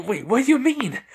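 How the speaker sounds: high-pitched flustered question; alarmed question. Acted. Boy asking the creature what it means, alarmed. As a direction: leap up high, taken aback.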